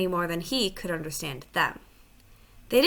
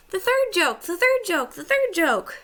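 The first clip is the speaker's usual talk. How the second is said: high, loud, excited squawk repeated; a jackdaw bursting with glee over the joke; great excitement; 11 semitones higher, 4 dB louder than usual. A narrator's reading. Jackdaw crowing to the other beasts in great excitement. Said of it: "said the jackdaw in great excitement".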